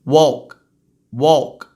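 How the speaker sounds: low, short "walk" ending on k; just a k. Shown as a demonstration. Teacher demonstrating, "walk" ending in bare k. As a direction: clip "walk" at the k, no vowel. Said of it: "É só um k".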